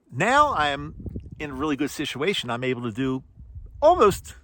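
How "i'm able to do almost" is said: normal talking voice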